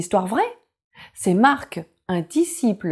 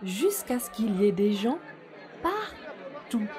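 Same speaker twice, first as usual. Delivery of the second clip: soft storytelling voice; 4 dB quieter than usual. A narrator's reading. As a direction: read gently to little children. Story narration begins.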